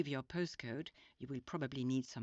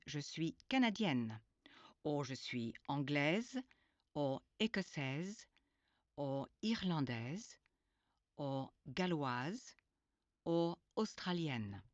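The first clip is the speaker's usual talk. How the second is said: canadienne, anglaise, écossaise with the final sound clearly voiced; teacher stresses the feminine endings; emphasized endings. Shown as a demonstration. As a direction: lean on the feminine ending of each word. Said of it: "the ending of the word is emphasized".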